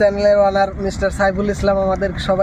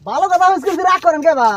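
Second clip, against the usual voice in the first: acted indignation; 7 semitones higher, 4 dB louder than usual. Acted quarrel, customer with seller.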